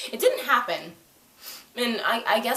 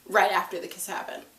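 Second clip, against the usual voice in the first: ordinary delivery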